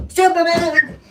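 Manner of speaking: loud high hero yell; heroic shout. Pretend. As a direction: shout it like flying off. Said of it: "launch myself".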